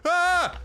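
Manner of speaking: high, sharp "ah"; sudden loud exclamation; loud gasp of relief. Pretend. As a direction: let out an exaggerated high cry. Drinks just before dying of thirst.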